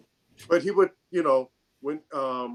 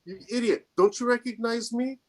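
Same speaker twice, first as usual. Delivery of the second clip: higher-pitched, mock-exasperated reproach; retold exasperation; 8 semitones higher than usual. Interrogator revealing himself to old friend, mock-exasperated. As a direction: imitate someone amazed he isn't recognized.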